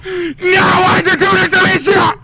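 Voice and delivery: very high, hysterical shrieking; panicked screaming. Acted. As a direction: scream in drawn-out acted panic. Officer screams in chaos of chase.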